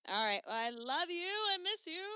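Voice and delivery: a silly voice for the sign-off; playful put-on voice saying goodbye to a friend; odd put-on voice. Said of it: "I don't know why I did that voice".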